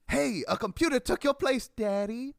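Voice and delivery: full-oomph high wrestler holler; over-the-top mock wrestler yell; Dusty Rhodes. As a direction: shout it way up high like a promo. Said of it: "with as much oomph as possible".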